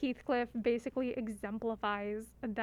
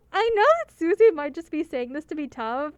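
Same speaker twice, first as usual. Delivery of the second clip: usual speaking voice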